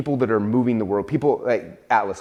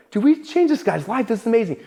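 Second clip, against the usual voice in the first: higher, delighted exclamation; excited; 12 semitones higher than usual. Retold or imitated. Founder recalling his delight at a customer's testimonial, higher and excited. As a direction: gush over a success story. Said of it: "I'm like".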